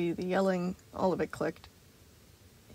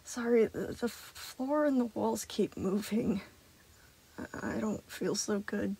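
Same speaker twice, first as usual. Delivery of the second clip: weak and dizzy; 4 dB quieter, slower than usual. A narrator's reading. Sun dizzy and unwell.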